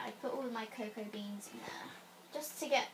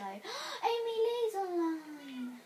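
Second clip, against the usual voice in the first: 1 excited gasp; 8 semitones higher, 3 dB louder, much slower than usual. Real, not acted. Gasps at friend online.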